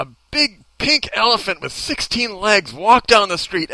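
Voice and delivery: ordinary talk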